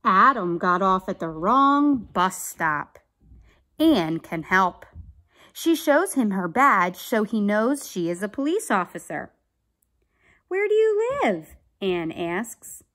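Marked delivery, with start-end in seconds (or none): 0.1-9.3 emphatic, projected storybook narration; read-aloud story. A narrator's reading. Teacher reading about lost Adam to children, animated narration. Reads a picture book aloud. 10.5-11.6 high-pitched acted question; officer's question. Ann asks lost Adam, high questioning voice.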